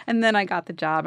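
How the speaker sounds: ordinary talk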